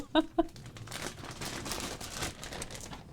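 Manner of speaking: airless laugh through tears; breathless teary laugh. Real, not acted. Streamer laughing breathlessly through happy tears. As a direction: laugh without voice, choked up.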